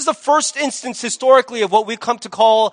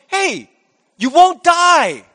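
loud, high, drawn-out brazen lie; full frontal assault; 7 semitones higher, 5 dB louder, slower than usual. Acted. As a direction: throw the lie straight at the listener, high and forceful. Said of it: "he just full frontal assault".

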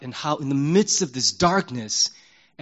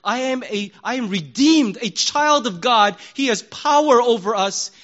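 declaring; 7 semitones higher, 4 dB louder than usual. Retold or imitated. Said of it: "we paused and said".

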